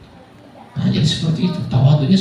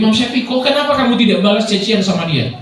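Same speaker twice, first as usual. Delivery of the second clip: questioner's puzzlement; 8 semitones higher than usual. Retold or imitated. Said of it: "Ditanya Imam Syafi'i".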